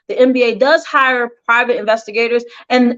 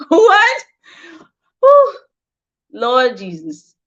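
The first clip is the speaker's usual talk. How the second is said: shocked; 4 semitones higher, much slower than usual. Real, not acted. Shocked by shooting news.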